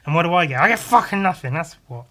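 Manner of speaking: rising bitter rant. Real, not acted. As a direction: vent it, building up to a bitter shout. Bitter rant about own work.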